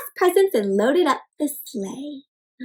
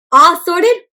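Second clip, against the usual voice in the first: Buck asking; 4 semitones higher, 6 dB louder, slower than usual. A narrator's reading. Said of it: "asked Buck".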